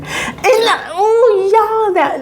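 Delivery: high, near-crying squeal; squeal, overwhelmed; gushing cry of delight. Real, not acted. Overcome by cute scene.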